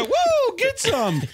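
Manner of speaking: very high mock whoop; battle cry. Game character firing the weapon, high mock war whoop. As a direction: squeal it out like an overexcited game hero. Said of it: "say some line".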